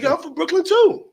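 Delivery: high, loud, eager imitation; excited magician. Magician bonding over Brooklyn, excited high voice. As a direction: blurt it out high-pitched and eager.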